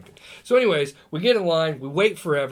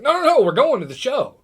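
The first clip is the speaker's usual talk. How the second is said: higher, louder retold protest; insistent protest; 8 semitones higher, 7 dB louder than usual. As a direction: protest urgently, imitating your own reaction. Acting out his protest at refusing tickets.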